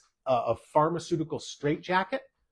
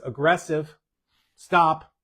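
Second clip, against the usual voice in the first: ordinary talk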